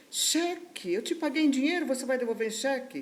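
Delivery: high-pitched incredulous client; mock the client's outraged disbelief; indignant disbelief. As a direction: throw it back as a high, indignant question. Client indignant at being offered cheque.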